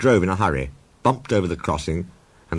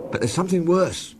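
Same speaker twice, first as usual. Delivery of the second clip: worried Toby voice; 11 semitones higher than usual. A narrator's reading. Toby character voice.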